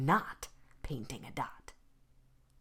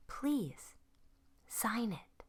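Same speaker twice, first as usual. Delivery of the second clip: gentle plea; 6 semitones higher, 4 dB quieter, much slower than usual. Acted. Vashti gently asks boy.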